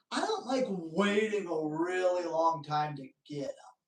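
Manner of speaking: whispered aside; whispering. Real, not acted. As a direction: drop to a whisper.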